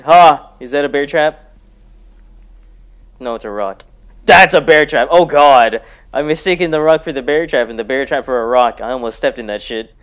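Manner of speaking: ordinary talk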